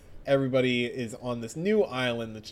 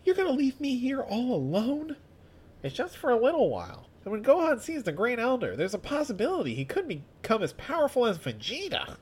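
high voice; 8 semitones higher than usual. Acted. Voicing Krillin.

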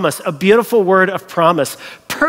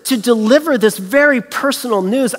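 usual speaking voice

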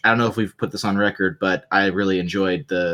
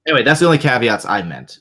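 ordinary talk

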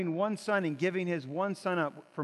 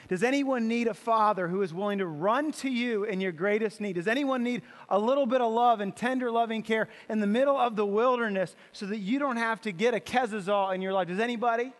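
loud, rising appeal to the crowd; fervent preaching; 3 semitones higher, 4 dB louder than usual. Acted. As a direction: call out to the whole church. Preaching at full force.

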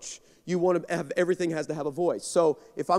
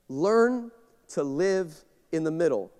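slow, deliberate restatement; slow emphatic repeat; 4 semitones lower, 3 dB quieter, slower than usual. Shown as a demonstration. Pastor repeating the point to the church, slow and weighty. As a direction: lean on each word, slowly. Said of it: "you need to learn to live in the middle".

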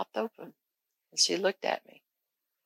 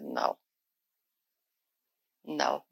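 soft stunned no; 4 semitones lower, 8 dB quieter than usual. Retold or imitated. Stunned refusal at deathbed.

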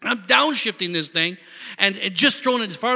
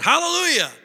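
sarcastic high sing-song hallelujah; mock her with a high, overdone hallelujah; husband mocking his wife's command, sarcastic; 11 semitones higher than usual. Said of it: "Just to mock her".

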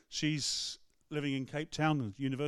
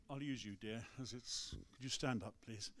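quiet request; 4 semitones lower, 13 dB quieter than usual. Retold or imitated. Retelling the preacher's quiet request.

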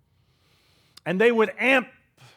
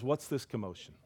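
quiet question; 7 semitones lower, 14 dB quieter than usual. Acted. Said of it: "That's his question".

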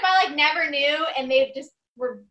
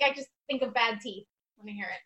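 usual speaking voice

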